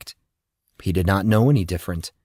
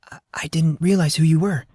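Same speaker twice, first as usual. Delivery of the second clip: higher, stammering nervously; nervous nurse stumbling over her words; fearful stammer; 7 semitones higher than usual. Acted. Shaken nurse explains herself to him, higher nervous stammer. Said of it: "The fear was still prevalent in her voice".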